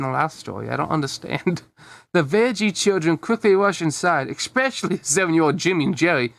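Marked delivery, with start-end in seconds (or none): none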